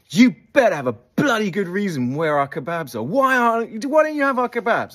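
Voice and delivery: hammed-up outrage over missing kebabs; demanding older boys.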